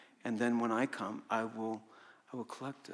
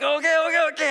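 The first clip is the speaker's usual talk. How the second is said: giddy okay; 10 dB louder, faster than usual. Retold or imitated. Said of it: "So I was like".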